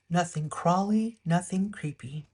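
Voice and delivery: ordinary delivery, nothing marked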